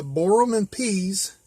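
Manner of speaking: ordinary delivery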